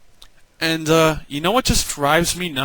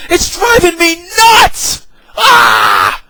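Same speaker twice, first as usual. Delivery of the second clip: furious outburst; 12 semitones higher, 6 dB louder, much slower than usual. Real, not acted. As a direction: blow up, half crying with rage. Frustrated rant boils over.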